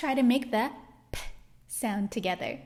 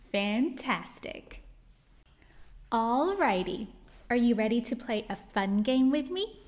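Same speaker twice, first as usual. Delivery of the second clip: usual speaking voice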